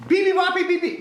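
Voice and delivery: very high, loud chirpy beeps; baby bird beeping. Shown as a demonstration. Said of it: "you can do this noise too".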